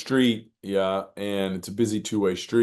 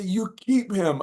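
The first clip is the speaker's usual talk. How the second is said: high, stretched-out call; acted holler, slow and pitched up; retold yell; 11 semitones higher, much slower than usual. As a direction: urge someone from across the street, re-enacted. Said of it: "I yell across the street".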